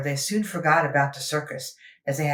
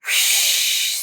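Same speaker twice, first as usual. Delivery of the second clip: breathy steam whoosh, sad; a small sad whoosh of an engine; unhappy whoosh. Acted. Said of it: "said Henry unhappily".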